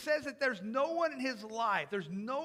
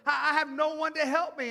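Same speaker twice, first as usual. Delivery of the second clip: loud, higher, defeated excuse; negative attitude; 4 semitones higher, 9 dB louder than usual. Retold or imitated. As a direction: whine it out loudly as a hopeless excuse. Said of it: "the negative attitude is like".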